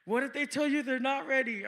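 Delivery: lightly voiced worried shopper; anxious lady. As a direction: press him anxiously. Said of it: "She's like".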